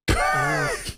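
very high squeal of a laugh. Real, not acted. Stunned at what he saw, squealed laugh.

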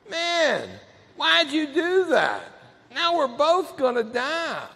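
high, plaintive acted lament; hurt, pleading victim voice; dying addict. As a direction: ask it weakly, stretched out and wounded. Bitten addict questions snake while dying.

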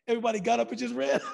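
fast, laughing through the line; laughing through words. Real, not acted. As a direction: race through it while cracking up. Laughing at own story.

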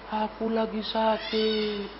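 soft, drawn-out whine of a patient; whining sick person. Acted. Sick man answering a friend's greeting, whining softly. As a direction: moan it slowly and quietly, fishing for sympathy. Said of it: "merengek sama kawan".

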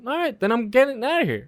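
high-pitched retold brush-off; defiant retort. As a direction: act out walking out on the cop. Retold defiant reply to cop.

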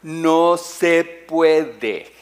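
"No se puede" lento y más fuerte; muy despacio. Shown as a demonstration. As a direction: remacha cada sílaba despacio. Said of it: "si lo leo despacito lo, lo entienden". (lentísimo y enfático)

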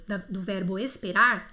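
usual speaking voice

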